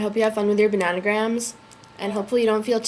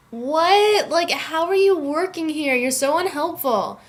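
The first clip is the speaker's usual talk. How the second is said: high, drawn-out incredulous complaint; exasperated disbelief; 7 semitones higher, slower than usual. Retold or imitated. Student to an unhelpful debate instructor, exasperated. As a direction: pitch it way up and stretch it out, aghast.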